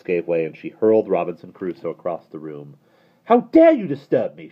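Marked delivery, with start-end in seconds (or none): 3.2-4.5 high, loud outburst; girl shouting at her brother; furious shout. A narrator's reading. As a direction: blow up at him. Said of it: "she shouted".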